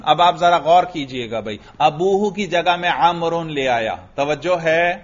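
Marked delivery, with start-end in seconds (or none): none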